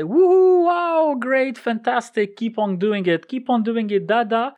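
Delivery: high, loud delighted cheer; cheering parent. Retold or imitated. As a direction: cheer like a thrilled parent over baby's first word. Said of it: "they will start and say".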